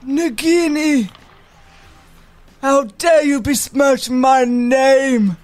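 slow, loud villain shout; hammy dark lord bellow; Voldemort voice. Acted. Voldemort role, acted scene.

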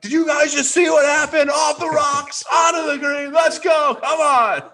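celebrating a lucky bounce off the rocks; retold hype shout. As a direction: shout it to the crowd like you just holed out. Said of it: "I'm like".

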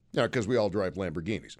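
deadpan sarcasm; sarcastic. Acted. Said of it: "That was sarcasm".

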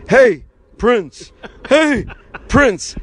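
high, loud calling out; high-pitched mock yell across a distance; imitated yelling. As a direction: holler to get someone's attention from afar. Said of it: "Sid started yelling".

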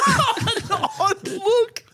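slow, high, cracked up with laughter; laughing repeat. Real, not acted. Repeating co-host's odd description back to him, laughing incredulously. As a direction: stretch it out through a big laugh.

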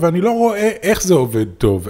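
ordinary talk

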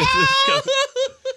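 rire aigu qui éclate; gros éclat de rire. Real, not acted. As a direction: rit à en pleurer. Fou rire après la blague. (éclat de rire aigu)

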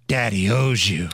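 low, creepy whispered mockery. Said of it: "in that weird whisper voice".

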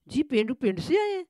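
bright quip tossed back, pitched high; chirpy. A narrator's reading. Village maid answers the madam cheekily, chirping high. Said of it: "وہ چہک کے بولی".

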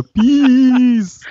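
high, loud drawn-out cheer; celebratory cry. Acted. Fan acting out the Gungan victory shout, high mock cheer. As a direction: let it soar up high and long.

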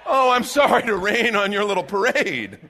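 loud, laughing mock apology; sarcastic apology. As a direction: rub it in with a laughing sorry. Sarcastic reply to pretend listener.

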